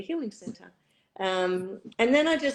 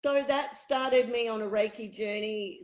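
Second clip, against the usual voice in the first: ordinary talk, nothing marked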